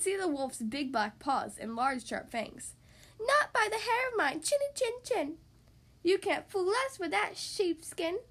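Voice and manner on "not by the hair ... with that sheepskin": high, defiant piggy voice; little pig. A narrator's reading. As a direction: refuse the wolf in a squeaky pig voice. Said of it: "said the second little pig".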